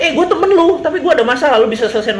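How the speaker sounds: high, chummy appeal; butter them up, pitched well up; a leader playing buddy to ask a favour; mock pleading friend. Acts leader asking favour.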